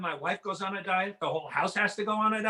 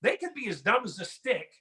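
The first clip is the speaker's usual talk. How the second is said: normal talking voice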